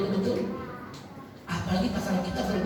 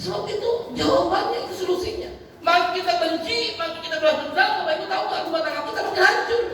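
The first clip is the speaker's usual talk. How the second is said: high-pitched, emphatic sermon; fervent preaching warning; 9 semitones higher, 3 dB louder than usual. Acted. Preacher warning couples against revenge, fervent. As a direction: drive the warning home from the pulpit.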